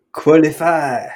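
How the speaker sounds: a hint of a French accent on qualify. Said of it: "That was French".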